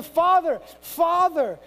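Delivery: high, loud, emotionally charged cry; exclaimed cry. Acted. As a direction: exclaim it as an urgent call to God. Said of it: "look to God and exclaim".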